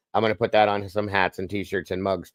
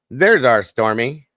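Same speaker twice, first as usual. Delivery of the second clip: loud, slow, drawn-out welcome; excited greeting; 6 semitones higher, 10 dB louder, slower than usual. Real, not acted. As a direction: call it out big and slow. Greeting a joining viewer.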